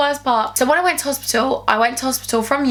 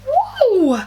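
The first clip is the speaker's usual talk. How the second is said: excited whoop; 11 semitones higher than usual. Real, not acted. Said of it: "feeling hot".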